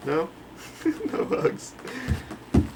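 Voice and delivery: ordinary talk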